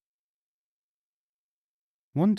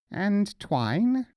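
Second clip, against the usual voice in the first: mullah asking; 9 semitones higher than usual. Acted. Mullah questions shopkeeper.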